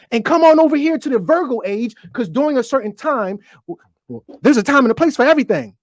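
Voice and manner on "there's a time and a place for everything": quick throwaway saying; tossed off at speed as a familiar line; rattled off. Shown as a demonstration. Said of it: "You ever heard that?".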